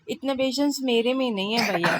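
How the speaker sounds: normal talking voice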